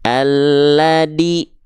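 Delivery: ALLADHI drawn out as "alladi", hard D; dal replacing zal. Shown as a demonstration. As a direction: swap the dh in "alladhi" for a plain d, slowly. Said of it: "Nah, jadi huruf dal". ("alladhi", dibaca dengan huruf dal)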